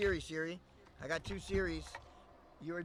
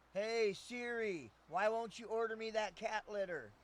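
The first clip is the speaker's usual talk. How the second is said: calling to Siri; 4 semitones higher, 4 dB louder than usual. Real, not acted.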